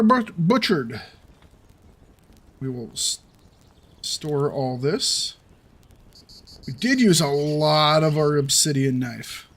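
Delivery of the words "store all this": normal talking voice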